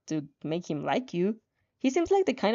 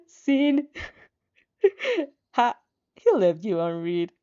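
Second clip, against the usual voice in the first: words broken by real laughter; laughing while reading; 3 semitones lower than usual. Real, not acted. Player laughing as Shoto leaves her unread. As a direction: read through giggles.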